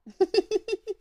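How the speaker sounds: high, loud laugh; high burst of laughter. Real, not acted. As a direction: laugh out at the dog. Laughing at the dog.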